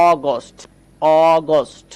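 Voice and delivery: "অগস্ট" with a long opening "aw", twice; teacher drawing out the first vowel of "অগস্ট"; stretched pronunciation. Shown as a demonstration. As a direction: hold the "অ" of "অগস্ট" before "গস্ট". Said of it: "এই জন্য অ উচ্চারণ করলাম".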